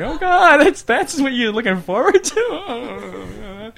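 hammed-up near crying; wanting to cry. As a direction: whimper it in pity for her. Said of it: "You, like, wanna cry for her".

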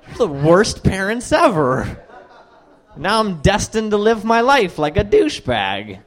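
ordinary talk

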